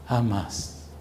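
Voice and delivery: quiet falling jamás; soft hush. Acted. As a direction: let jamás fall to a soft whisper. Emphatic repetition of never.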